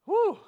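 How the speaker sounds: a loud, high exhale of relief; real relief breaking through; relieved whew. Real, not acted. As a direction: blow out the tension. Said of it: "I'm getting emotional".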